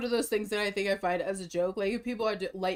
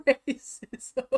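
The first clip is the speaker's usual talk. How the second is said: ordinary talk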